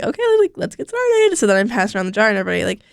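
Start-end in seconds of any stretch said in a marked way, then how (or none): 0.0-1.4 much higher, bright group-leader kickoff; perky leader voice. Retold or imitated. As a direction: chirp it like a cheerful youth leader starting the session. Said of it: "and I was like".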